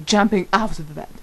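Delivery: ordinary delivery, nothing marked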